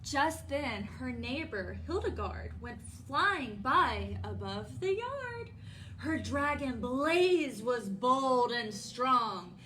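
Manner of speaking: bold, full storybook narration; reading to children. A narrator's reading. Storytime host introduces the neighbor's dragon to children, bold narration. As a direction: read it out big for the dragon. Reads picture book to children.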